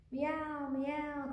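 high, drawn-out storybook meow; cat meow. A narrator's reading. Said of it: "cried the cat".

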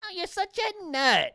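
put-on high cooing baby-talk voice; mock baby talk. Podcaster repeats the streamer's cringe line to his dog, high mock baby talk. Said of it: "That's the cringe nut".

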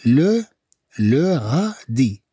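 "le" alone, then slow "le غادي"; drawn-out teaching pronunciation; slow syllables. Shown as a demonstration. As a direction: sound it out with long syllables. Teacher sounds out word slowly.

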